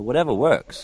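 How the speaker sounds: ordinary delivery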